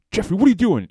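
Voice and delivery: put-on high, loud shout at the kid; scolding art teacher. Art teacher catching Jeff drawing on his face, loud scolding call. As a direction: bark his name across the room. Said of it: "it's like".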